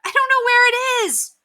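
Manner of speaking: high, loud flustered cry. A narrator's reading. New neighbor cannot help stop fight, crying out. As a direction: wail it out helplessly, pitch way up. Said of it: "cried the new neighbor".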